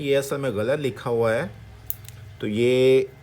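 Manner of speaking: ordinary talk, nothing marked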